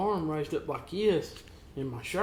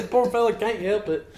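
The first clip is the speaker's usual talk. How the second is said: ordinary talk, nothing marked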